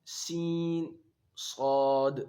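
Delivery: سین and صاد said carefully; teacher showing similar letters; letter articulation. Shown as a demonstration. Teacher contrasting similar letters, careful articulation. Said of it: "ہم آواز حروف".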